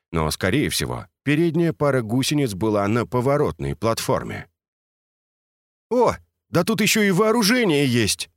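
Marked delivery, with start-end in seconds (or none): 5.9-8.3 higher, excited call; shouting. A narrator's reading. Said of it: "крикнул Миша".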